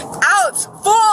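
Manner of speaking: loud insult snapped out; angry shout. Real, not acted. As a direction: yell it in his face. Yelling at officer.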